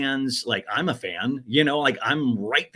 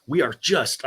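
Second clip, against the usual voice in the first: ordinary talk, nothing marked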